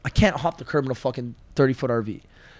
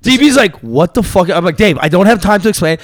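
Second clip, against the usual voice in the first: louder, higher, rushing the retell; loud excited retelling; 4 semitones higher, 8 dB louder than usual. Retold or imitated. Telling friends about the frantic call, loud and excited. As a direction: shout the story out in disbelief.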